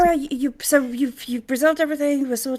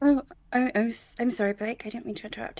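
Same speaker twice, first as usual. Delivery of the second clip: sheepish; 8 dB quieter than usual. Acted. Sheepish call to Blake.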